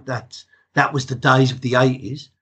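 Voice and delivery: ordinary talk, nothing marked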